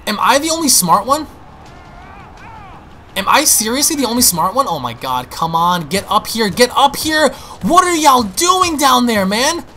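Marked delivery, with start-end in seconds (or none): 0.1-4.7 incredulous, higher-pitched complaint; exasperated. Real, not acted. Exasperated at teammates lagging behind. 6.5-9.6 higher, drawn-out frustrated call; exasperated yelling. Real, not acted. Gamer calling teammates up to him, exasperated.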